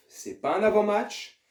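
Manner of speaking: ordinary talk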